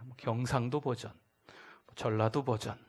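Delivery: ordinary delivery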